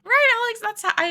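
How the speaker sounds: high, loud, eager callout; excited shout. Real, not acted. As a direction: burst out to greet Alex. Loud excited reply to chat.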